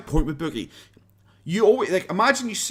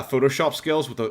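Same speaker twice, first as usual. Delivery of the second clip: normal talking voice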